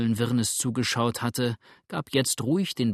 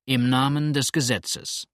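ordinary delivery

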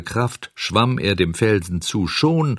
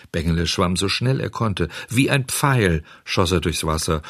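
ordinary delivery

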